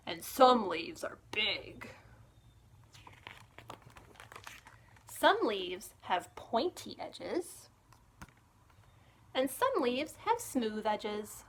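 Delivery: bright, lively storybook reading; reading to children. Acted. Librarian reading a picture book to children, lively storytime voice. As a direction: read it like storytime for little ones.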